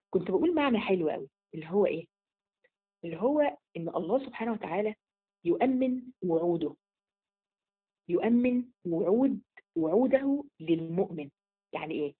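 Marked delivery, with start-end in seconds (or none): 5.4-11.3 slow emphasis. Shown as a demonstration. Teacher spelling out meaning slowly.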